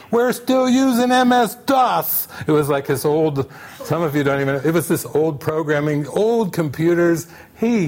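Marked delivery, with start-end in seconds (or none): 0.1-2.3 high, slow mock outburst; drawn-out, hammed-up exasperation; exasperated anger. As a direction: imitate a fed-up clerk wailing about the company. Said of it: "really angry".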